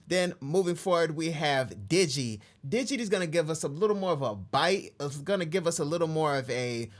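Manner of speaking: ordinary delivery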